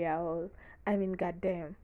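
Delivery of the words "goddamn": ordinary talk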